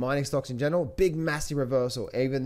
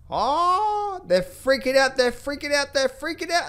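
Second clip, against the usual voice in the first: much higher, squeaky mock-panicked voice; 11 semitones higher, slower than usual. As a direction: parody the traders losing their minds, way up high. Mocking panicking market crowd.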